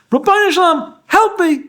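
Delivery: high, mock-wailing plea; baby's cry for help. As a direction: wail it like a helpless baby in a tantrum. Voicing a crying baby's prayer.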